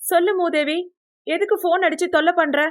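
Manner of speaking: high, impatient phone greeting; irritated. A narrator's reading. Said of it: "கடுப்புடன் போனை எடுத்தவள்". (கடுப்பான உயர்ந்த குரல்)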